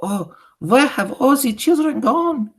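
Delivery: high, loud wail of bewilderment; mock cry. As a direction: witch mimicking distraught teachers. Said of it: "The teachers cry".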